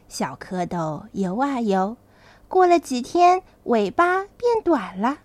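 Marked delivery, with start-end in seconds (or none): none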